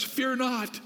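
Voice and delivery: FEAR not called out high for the congregation; proclaimed high. Shown as a demonstration. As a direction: lift FEAR and hold it. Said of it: "Say that with me".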